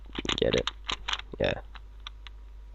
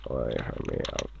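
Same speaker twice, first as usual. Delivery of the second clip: deep voice; 12 semitones lower, 16 dB quieter, faster than usual. Acted. Repeated low shooing.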